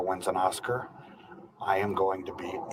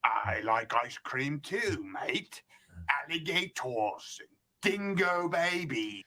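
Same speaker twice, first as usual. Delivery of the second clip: a hint of Australian, higher and slow; mock Australian accent; 7 semitones higher, slower than usual. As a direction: play the Aussie bloke lightly. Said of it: "Watch this Australian thing".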